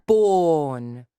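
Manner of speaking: "born" drawn out with no r; British vowel drill. Shown as a demonstration. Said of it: "I'm doing it really horribly now".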